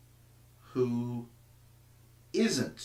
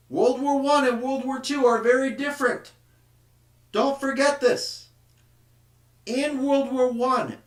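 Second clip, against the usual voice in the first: loud, higher victory address to the troops; triumphant officer's speech; 7 semitones higher, 9 dB louder than usual. Acted. Teacher voicing an officer congratulating German soldiers, loud and higher. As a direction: praise the soldiers like a proud commander.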